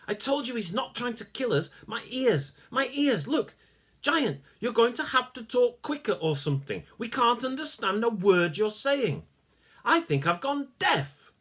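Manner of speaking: high, whiny, pained voice; complaining little man. Acted. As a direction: moan it out. Malcolm complains.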